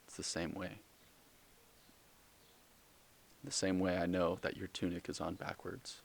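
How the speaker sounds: soft, measured deadpan reply; Jesus, intent. Acted. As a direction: say it quietly, with long knowing pauses. Acts Jesus answering after an intent stare.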